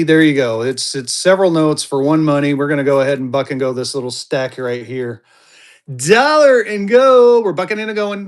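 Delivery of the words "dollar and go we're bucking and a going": hype sale call. Real, not acted. Seller opening buck and go stack, hype sale call. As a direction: call it out long and loud to open the sale.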